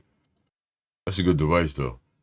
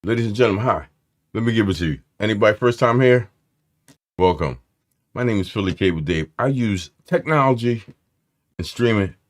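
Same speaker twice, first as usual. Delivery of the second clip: louder, higher showman address; announcer intro; 5 semitones higher, 6 dB louder than usual. Real, not acted. Host introducing himself to first-time viewers, showman style. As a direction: present yourself like a host on stage.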